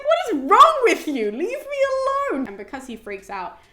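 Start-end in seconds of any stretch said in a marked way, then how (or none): 0.0-2.5 loud, very high exasperated yell; losing it. Retold or imitated. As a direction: act out snapping after repeated provocation. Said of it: "Apollo loses his shit".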